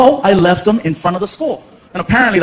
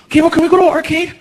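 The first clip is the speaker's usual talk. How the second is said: put-on high hopeful boy; high eager kid; 7 semitones higher, faster than usual. Son voiced asking.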